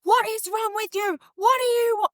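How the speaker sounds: high, sharp scolding yell; high-pitched retold yell at a toddler; mock yell at child. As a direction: snap at the kid in frustration. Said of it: "yelled at him".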